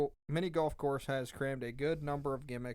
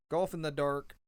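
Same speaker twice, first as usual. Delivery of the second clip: usual speaking voice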